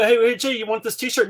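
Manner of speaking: high, loud teasing offer of a shirt; mock family voice. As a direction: pitch it way up like a relative dangling a gift. Family offering gift, mocked.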